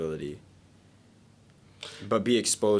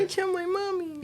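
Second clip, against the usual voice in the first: high squeaky crybaby voice; hammed-up whiny child; mock whiny kid. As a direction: whine like a little kid tattling. Mock kid crying to mom.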